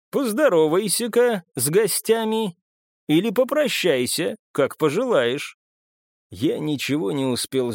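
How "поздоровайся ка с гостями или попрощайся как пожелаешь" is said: higher, lilting invitation; playful villain host. A narrator's reading. Marcus telling the woman to greet guests, playfully coaxing. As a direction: lift the pitch as if coaxing someone out.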